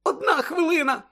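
very high urgent cry; panicked cry. Acted. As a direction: cry out as time runs out. Hermione panics about time. (панічний високий вигук)

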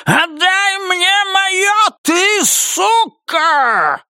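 very high, drawn-out shriek; piercing inhuman wail. A narrator's reading. Роза Марена demanding what is hers, inhuman shrieking. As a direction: shriek your demand like something no longer human. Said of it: "пронзительно завопила Роза Марена совершенно нечеловеческим голосом".

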